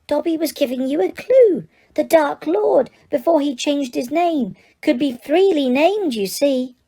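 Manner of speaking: high eager house-elf explaining. Acted. Dobby explains his clue to Harry, high elf voice. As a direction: squeak it out as if it were obvious.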